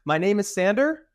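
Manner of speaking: high, with SANDER rising at the end; nervous questioning voice. Acted. Nervous person introducing himself after breathing in, questioning high voice. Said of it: "You breathe in more".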